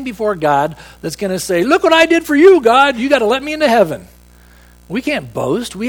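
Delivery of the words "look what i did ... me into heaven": much higher and louder, then rushing; hammed-up proud sinner insisting he earned heaven; mock boastful demand. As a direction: crow it like a self-righteous man pushing God for his due. Imagined boaster demanding heaven from God.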